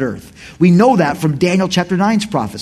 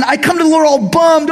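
ordinary talk

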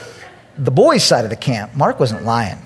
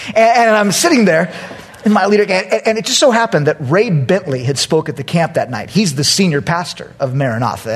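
usual speaking voice

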